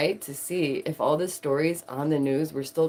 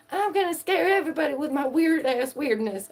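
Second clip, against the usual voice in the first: mock spooky boast; 9 semitones higher than usual. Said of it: "Don't be like".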